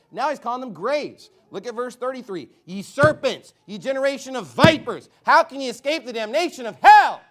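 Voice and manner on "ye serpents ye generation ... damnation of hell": high, loud, building to a roar; thundering condemnation. Acted. Preacher voicing Christ condemning the Pharisees, thundering. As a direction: preach it at full force, peaking on the last word.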